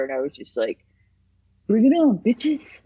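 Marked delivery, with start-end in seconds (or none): 1.7-2.8 higher, louder mock challenge; chip-on-shoulder defiance. Bullied kid turned tough, taunting bullies defiantly. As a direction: throw down a tough dare. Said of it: "this chip on my shoulder".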